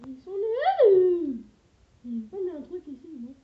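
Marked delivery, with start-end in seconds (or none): 0.1-1.4 dismissive drawl. Real, not acted. Repeats dismissively, slow and loud.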